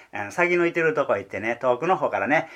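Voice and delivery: normal talking voice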